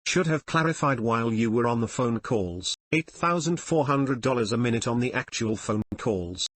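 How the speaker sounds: ordinary delivery, nothing marked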